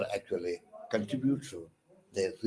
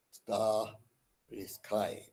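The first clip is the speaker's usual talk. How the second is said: very slow, much softer echo; 10 dB quieter, much slower than usual. Shown as a demonstration. Teacher repeating the line's end quietly, drawn out. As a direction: let it fade out slowly. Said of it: "Under the wide and starry sky".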